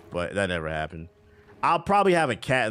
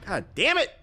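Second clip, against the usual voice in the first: high-pitched exasperated curse; real frustration; 13 semitones higher than usual. Real, not acted. Streamer reacting to the game, exasperated outburst.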